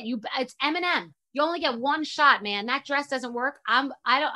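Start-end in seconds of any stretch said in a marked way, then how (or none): none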